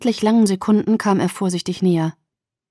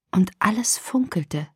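whispered; 6 dB quieter than usual. A narrator's reading. Hushed wonder in narration.

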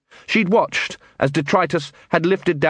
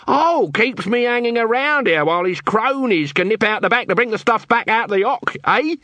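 high, wheedling accusing patter; Nobby's voice; 9 semitones higher than usual. Acted. Nobby bluffing the quartermaster with a high accusing patter. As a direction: lay the accusation on thick, pitched high.

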